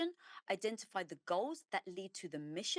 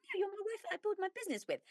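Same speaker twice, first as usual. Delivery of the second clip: husband's voice; 13 semitones higher, much faster than usual. Retold or imitated. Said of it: "He's like".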